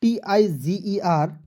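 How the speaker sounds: letters T I जी E R spelled slowly; teacher spelling for the class. Shown as a demonstration. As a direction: spell each letter deliberately. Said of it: "tiger.".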